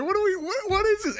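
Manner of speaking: high, laughing disbelief; what is this, squeaked up high; baffled by Denzel in Gladiator, high and laughing. Retold or imitated. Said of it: "I'm like".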